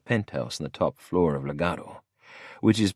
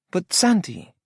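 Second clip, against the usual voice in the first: higher female voice, pleading; Lola pleading; 13 semitones higher than usual. A narrator's reading. Lola pleads with Santi to let her go, higher. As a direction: whine his name.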